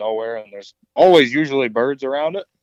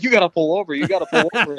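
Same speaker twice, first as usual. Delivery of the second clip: excited; 6 semitones higher, faster than usual. Retold or imitated. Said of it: "I'm like".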